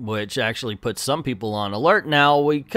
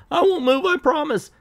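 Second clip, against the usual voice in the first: scared promise; 3 dB louder than usual. Retold or imitated. Captive reassures the robot.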